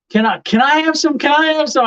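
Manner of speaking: light imitation of small children asking; dad voicing his small kids begging for a taste.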